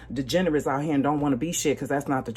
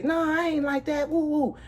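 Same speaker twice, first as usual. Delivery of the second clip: much higher, squeaky denial with a little woo; mock prissy denial; 11 semitones higher than usual. Brushing off the man at the funeral home, in a mock high innocent voice. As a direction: play coy and innocent up high. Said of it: "I was about to stop and say".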